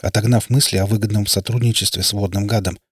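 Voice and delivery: ordinary delivery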